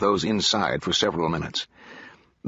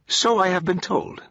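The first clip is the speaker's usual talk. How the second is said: higher, amused android reply; with a smile; 10 semitones higher than usual. A narrator's reading. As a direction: smile through the words. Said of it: "the android replied with a smile".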